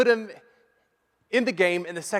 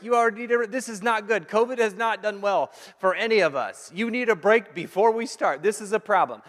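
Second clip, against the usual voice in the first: put-on higher, louder teasing rant at the kid; mock scolding; 7 semitones higher, 7 dB louder than usual. Pastor pretending to scold a young player, exasperated mock rant. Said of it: "I didn't say that to the kid".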